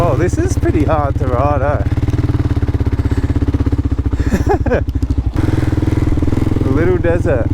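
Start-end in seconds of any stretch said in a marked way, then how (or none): 1.9-6.6 breathless laugh. Real, not acted. Hard riding in sand.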